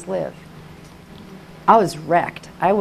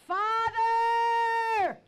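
high, wailing vow to God; tearful high wail, stretched out; sobbing cry; 13 semitones higher, 9 dB quieter, slower than usual. Retold or imitated. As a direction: cry it out slowly, voice pitched way up.

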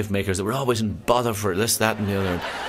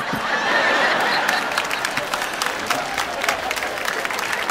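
laughing. Real, not acted. Laugh after punchline.

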